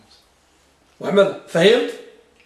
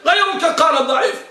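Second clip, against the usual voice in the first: raised, higher voice saying it bluntly; mock blunt shout; 8 semitones higher than usual. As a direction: bark out the blunt verdict. Teacher contrasts blunt scholars. (صيحة حادة ساخرة)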